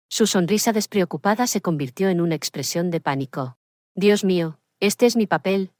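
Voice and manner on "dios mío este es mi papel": plain reading voice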